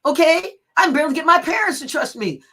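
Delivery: retold exasperation, high and loud; exasperated outburst. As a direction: blurt it out loudly in disbelief. Acting out her exasperated reaction.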